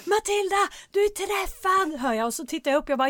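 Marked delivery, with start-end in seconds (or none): 0.0-2.0 high mock call-out; raised, accusing imitation of an onlooker; imitated shout. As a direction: call her out like a shouting spectator. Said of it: "Och då skriker två andra".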